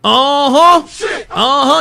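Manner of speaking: loud, high, teasing uh-ohs with a laugh; gleeful uh-oh. Real, not acted. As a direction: relish the trouble about to start. Streamer reacting to taser drawn.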